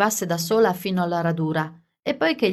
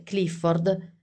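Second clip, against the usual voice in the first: usual speaking voice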